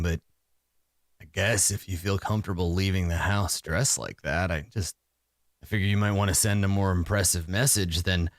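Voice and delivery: lightly voiced shopkeeper looking down on the armor; dismissive shopkeeper. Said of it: "he says".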